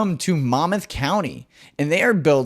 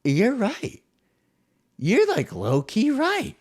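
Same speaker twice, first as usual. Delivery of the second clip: high mock reply; 10 semitones higher, slower than usual. Reacts to own quote.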